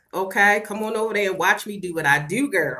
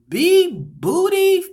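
drawn-out name; 10 semitones higher, 7 dB louder, much slower than usual. Real, not acted. Reading a viewer name playfully.

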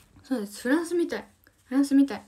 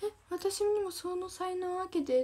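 envious plea; 8 semitones higher than usual. Retold or imitated. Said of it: "って思いました".

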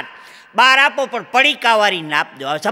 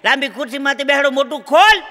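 loud, raised-pitch orders; commanding dentist; 5 semitones higher, 5 dB louder than usual. Acted. Dentist to patient in the long chair, loudly commanding. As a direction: order the patient about briskly.

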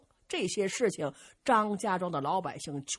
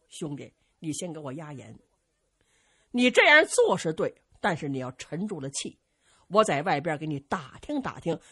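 calming elder brother; 5 semitones lower than usual. Acted. Zhang Delu tells Zhu Can to stay calm, low steady voice. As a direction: settle him down firmly.

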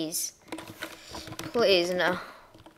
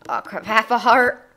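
normal talking voice

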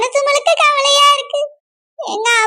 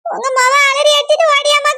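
ordinary delivery

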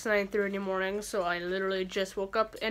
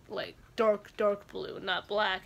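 usual speaking voice

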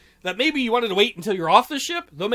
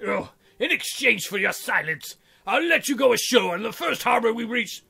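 ordinary talk

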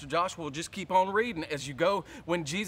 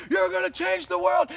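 Sunday shout; 7 semitones higher, 4 dB louder than usual. Retold or imitated. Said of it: "All faith on Sunday where I'm like".

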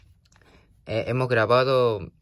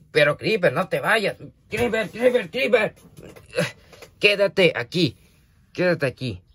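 high, urgent calls after a pet; alarmed calling; 6 semitones higher than usual. Real, not acted. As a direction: call them back, pitch climbing on each name. Calling back pet that leaves. (llamada aguda y apremiante)

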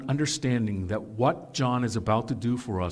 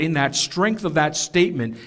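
ordinary talk, nothing marked

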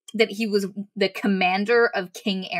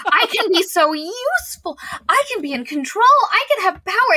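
rising higher and louder, a mild gloating build; power-drunk; 12 semitones higher, 5 dB louder than usual. Retold or imitated. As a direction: lightly play the thrill of power. Skadi grows drunk on power.

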